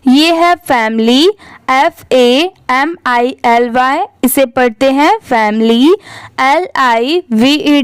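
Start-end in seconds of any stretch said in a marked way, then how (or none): none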